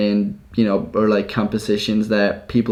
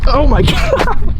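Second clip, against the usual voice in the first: high, slow, loud pained cry; stubbed toe; 7 dB louder, much slower than usual. Real, not acted. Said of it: "I just stubbed my toe".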